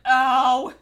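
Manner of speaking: loud fake yelp of pain; pained ouch. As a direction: cry out like it stings. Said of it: "you're like".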